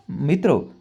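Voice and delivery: plain reading voice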